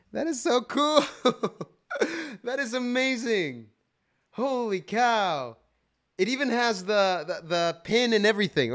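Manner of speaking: high, loud, laughing delight; delighted squeal. Real, not acted. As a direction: squeal with joy over a gift. Squealing over the gift.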